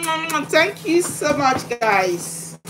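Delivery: normal talking voice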